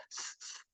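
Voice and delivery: "S, s" as two soft, voiceless hisses; S sound. Shown as a demonstration. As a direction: demonstrate "S, s" without any voice. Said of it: "normalmente tem som de S".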